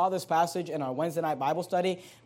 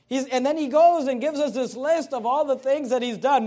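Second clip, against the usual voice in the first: high, loud pulpit delivery; impassioned preaching; 6 semitones higher, 5 dB louder than usual. Acted. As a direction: preach it hard, driving each boast home as Paul. Preaches at full force, voicing Paul.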